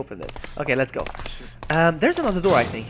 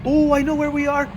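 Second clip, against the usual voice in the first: excited; 11 semitones higher than usual. Real, not acted. Realizing where they are.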